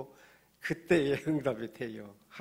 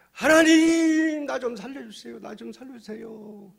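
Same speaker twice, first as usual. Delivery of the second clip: desperate cry for help; 9 semitones higher, 10 dB louder than usual. Acted. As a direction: wail to heaven for rescue. Man dangling from cliff cries out.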